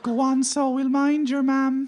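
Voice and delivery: very high, loud, slow mammy voice; Irish mammy. Acted. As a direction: coax them like a fussing Irish mother. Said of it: "women do fix things in ads".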